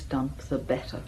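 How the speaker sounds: whispered aside. Acted. Bitter remark about leaving the dump.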